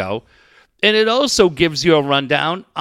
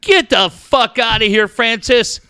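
mock yell; 5 semitones higher, 4 dB louder than usual. Yelling at pool-peeing kid.

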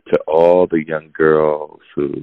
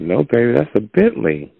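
higher-pitched mock insistence; gently insist, pitched up; insistent correction; 8 semitones higher than usual. Friend correcting partner about the car, insistent. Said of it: "And I'm like".

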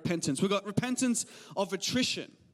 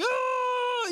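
mock crying kid. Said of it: "they're crying".

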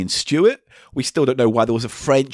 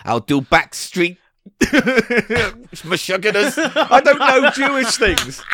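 high, slow, laughing rabbi pitch; hammed-up desperate rabbi touting gigs; down on his luck rabbi; 5 semitones higher, slower than usual.

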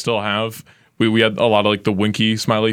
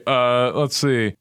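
ordinary talk

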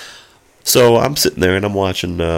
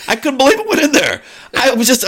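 high, fast and amazed; rising astonishment; faster than usual. Real, not acted. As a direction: still can't believe it happened. Recalls the catch in amazement.

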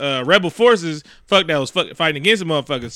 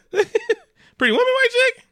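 laughing disbelief; slower than usual. Real, not acted. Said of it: "incredulous laughing repeat".

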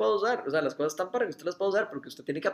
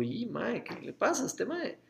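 puzzled surprise; 6 semitones higher, 3 dB quieter than usual. Retold or imitated. Father as his son bursts into tears: higher, baffled surprise. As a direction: pitch jumps much higher on the question, baffled. Son suddenly starts crying.

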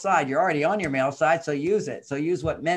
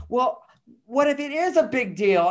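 higher, a touch indignant objection; objecting woman; 8 semitones higher, 3 dB louder than usual. Retold or imitated. Listener objecting to his advice, higher and indignant. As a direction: push back like a doubting listener. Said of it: "some people say".

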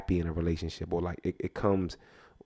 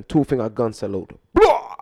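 gunshot sound; 8 dB louder than usual. Acted. S0 playing Jamaican, loud mock gunshot. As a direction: fire off a mock gun salute. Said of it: "Y'all know I'm Jamaican too".

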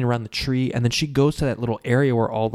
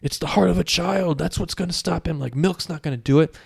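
put-on higher, urgent old woman voice; lightly voiced fretting old lady; old lady warning; 6 semitones higher than usual. Old lady warning nobody listens, urgent. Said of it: "the old lady being like".

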